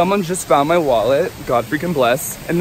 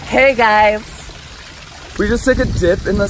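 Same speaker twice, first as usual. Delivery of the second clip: upbeat vlog greeting; 4 semitones higher than usual. Real, not acted. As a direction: greet the viewers brightly.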